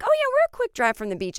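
very high, bright and breezy landlady. Retold or imitated. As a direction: chirp it like nothing is wrong. Quoting the landlady's cheerful reply.

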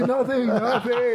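high, giggling through the words; laughing. Real, not acted. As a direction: laugh as you say it. Laughing while retelling the proposal.

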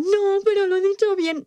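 put-on high-pitched whiny child protest; mock insufferable kid. Woman mimicking quiz-show kids who hated losing, mock bratty protest. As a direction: imitate a know-it-all kid contesting the answer. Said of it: "iban niños muy repelentes también".

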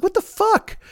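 high-pitched stunned what the fuck; shocked squeak of outrage; incredulous shriek. Retold or imitated. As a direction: squeal it in total disbelief. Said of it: "I'm just like".

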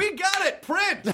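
very high-pitched hammy director's yell; director calling out. Acted. Director announcing the take is done, in a high mock shout. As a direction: sing out the wrap in a strained high voice.

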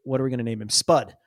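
ordinary talk

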